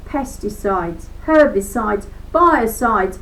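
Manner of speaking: ordinary delivery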